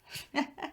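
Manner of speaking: brief, breathy little laugh; soft chuckle. Acted. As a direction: chuckle lightly at a fond memory. Amused by children's question.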